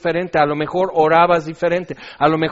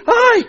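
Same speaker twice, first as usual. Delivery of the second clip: high, loud yelp of alarm; hammed-up squeal; mock shriek; 9 dB louder than usual. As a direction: yelp as if recoiling. Mimics recoiling from harsh sight.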